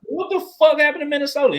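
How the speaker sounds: exclaimed question. Real, not acted. Restating the question louder.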